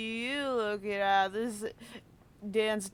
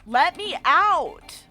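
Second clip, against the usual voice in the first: louder, slow, stretched-out demand; drawn-out plea; 4 semitones higher, 7 dB louder, much slower than usual. Real, not acted. Player begging the game to let her out, slow and loud. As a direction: drag out the plea to escape. Stuck in cutscene again.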